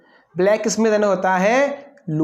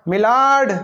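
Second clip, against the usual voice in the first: judge impression; 5 semitones higher, 4 dB louder than usual. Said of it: "जज साहब".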